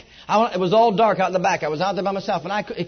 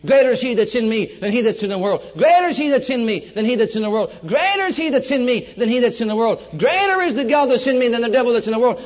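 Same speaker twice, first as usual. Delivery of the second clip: repeated verse, growing louder each time; fervent scripture declaration; 4 semitones higher, 5 dB louder than usual. Retold or imitated. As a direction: proclaim it like a battle cry of faith. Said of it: "my spirit began to speak".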